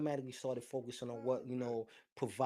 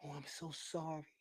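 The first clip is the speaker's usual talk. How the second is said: breathy, softer fake condolence; mock sympathy; 6 dB quieter than usual. Mocking people who fake sympathy for a stranger's loss, breathy and soft. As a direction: parody the hollow sympathy people offer. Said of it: "and they go".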